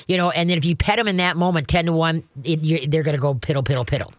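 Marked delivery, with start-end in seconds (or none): none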